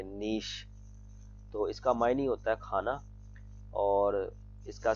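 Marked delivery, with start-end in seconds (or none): 0.0-0.7 नीश ending on a sh sound; long ee in नीश, closing on sh; pronunciation demo. Shown as a demonstration. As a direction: say नीश as "neesh", softly. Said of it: "शीन आता है".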